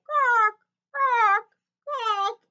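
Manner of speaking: big ducks calling from afar, loud high quacks; big ducks quacking. A narrator's reading. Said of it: "The big ducks say".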